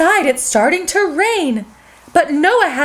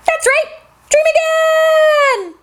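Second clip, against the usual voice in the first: high, slow, shouted title; show title shout; 11 semitones higher, slower than usual. Acted. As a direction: belt out the show's name.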